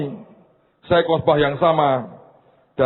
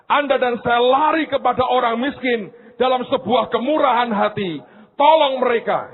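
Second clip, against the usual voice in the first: high-pitched, driving sermon delivery; exhorting preacher in full flight; impassioned preaching; 10 semitones higher than usual. Real, not acted.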